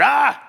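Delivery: sudden high, loud shriek; high scream from the next campsite; retold scream. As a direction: let out a startled woman's scream. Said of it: "Somebody over there was screaming".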